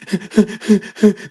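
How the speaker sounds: high mock sob of the son; a child's sob imitated. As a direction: sob before the answer. Son sobbing before answering.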